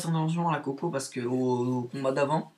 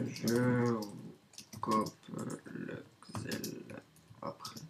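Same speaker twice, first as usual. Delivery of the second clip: half-whispered, much softer, to himself; a private whispered note mid-fight; whispered aside; 3 semitones lower, 8 dB quieter, much slower than usual. Real, not acted. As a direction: mutter the plan under your breath. Muttered plan to himself mid-fight.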